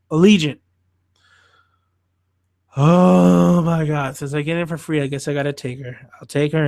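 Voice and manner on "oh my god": long drawn-out weary oh my God; exasperated groan. Real, not acted. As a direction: drag it out, fed up. Dreading final Divergent film.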